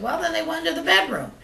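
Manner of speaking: high squawky parrot voice. Acted. Joke teller voicing the parrot to its owner, high and squawky. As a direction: pitch way up like a talking bird.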